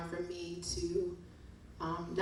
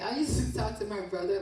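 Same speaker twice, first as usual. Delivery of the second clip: ordinary delivery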